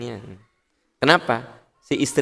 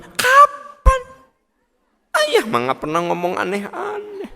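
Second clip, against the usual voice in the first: husband's indignant denial; 3 dB louder than usual. Acted. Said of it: "Cek ibu teh kieu".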